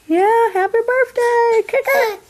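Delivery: high, slow cooing voice. Real, not acted. Sing-song birthday to baby.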